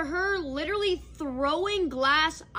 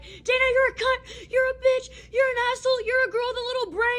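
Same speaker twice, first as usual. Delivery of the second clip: high, loud mock scream of insults, faster at the end; imitated screaming insults; 10 semitones higher, 4 dB louder than usual. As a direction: shriek the insults like the raging roommate. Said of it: "She screams on the top of her lungs".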